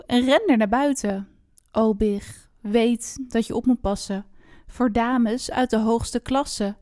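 expressive rhymed fairy-tale reading; story narration. A narrator's reading. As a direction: read it out like a storyteller, phrase by phrase. Reads a rhymed fairy tale aloud. (sprookje voorlezen, verhalend)

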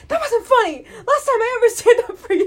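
high, loud offended scolding; imitated indignant brother. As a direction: protest like a betrayed brother. Imitates hurt, angry brother.

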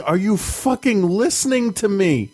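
high-pitched mock frustration; exasperated outburst. Mother voicing her frustration at daughter, high exasperation. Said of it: "I just wanna be like".

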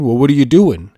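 lightly voiced teacher who caught you out; teacher catching you. To the driver, like a teacher catching a kid: mild scolding. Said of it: "in the kind of tone that, like, a teacher".

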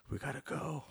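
soft, laughing we gotta go; half-whispered, laughing at his own panic; laughing nervous aside. Retold or imitated. Retelling nervous urge to leave.

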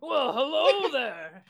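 goliath greeting. Acted. Goliath answers barkeep in character.

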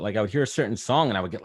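ordinary talk, nothing marked

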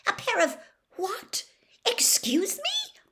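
put-on high, breathy outraged girl's voice; spluttering disbelief; indignant. A narrator's reading. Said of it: "a splutter of indignation".

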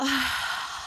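slow audible breath out; long exhale. Shown as a demonstration. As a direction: let the breath go in a soft sigh. Breathes out in exercise.

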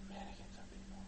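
very soft, voiceless echo; whisper. Real, not acted. Player echoing a friend's creepy discovery, whispered. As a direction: whisper it back.

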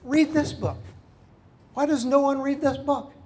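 high and loud, pleading to read the book; exasperated preacher; urgent exhortation. Real, not acted. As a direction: plead with them to open the Bible. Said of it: "exhorting the congregation".

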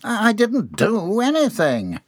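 high, stammering, drawn-out plea; whiny protest. A narrator's reading. Fired employee protesting innocence, whiny. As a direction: stumble in, then wail your innocence. Confused John protests firing.

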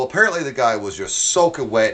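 ordinary delivery

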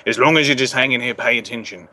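lightly put-on pirate voice, dropping away at the end; Jack Sparrow. Shown as a demonstration. Accent trainer dropping into the character, pirate voice. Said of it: "or if it's Jack Sparrow".

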